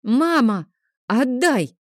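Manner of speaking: a stubborn son demanding his sword back; demanding boy. Acted. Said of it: "потребовал Лео". (требовательный детский голос)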